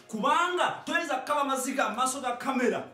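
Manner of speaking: normal talking voice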